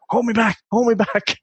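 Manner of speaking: mock schoolyard tough. Schoolboy posturing at his mates, mock bravado. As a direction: play the lad who begs his mates to hold him back.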